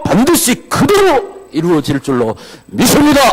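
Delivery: high, loud exhortation; full-force preaching. Real, not acted. Preacher urging believers to cry out in prayer, shouting. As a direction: shout the faith out to the church.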